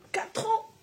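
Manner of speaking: high rising amazed question; surprised. Real, not acted. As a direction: gasp it out, amazed. Amazed reply to child's age.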